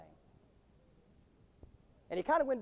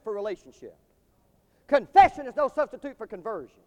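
building, higher and louder pulpit declarations; emphatic preaching; 5 semitones higher, 8 dB louder than usual. Real, not acted. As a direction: drive each point home in preaching cadence.

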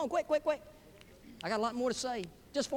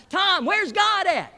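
loud, high, drawn-out holler; 10 semitones higher, 10 dB louder, much slower than usual. Acted. Preacher hollering at silent Tom in his office, full holler. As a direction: bellow each word slowly at him. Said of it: "the preacher just hollers out".

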